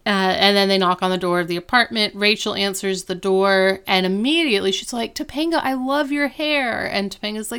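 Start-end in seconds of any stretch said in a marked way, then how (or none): 5.1-6.9 high-pitched, lilting gush; gushing compliment. Retold or imitated. Rachel answering the door to Topanga, gushing. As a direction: greet a friend with effusive praise.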